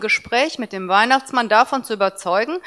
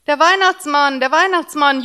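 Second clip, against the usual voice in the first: put-on high, loud cheering child; child cheering at Santa; 6 semitones higher, 5 dB louder than usual. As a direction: cheer it twice in delight. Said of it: "jubelte Tim".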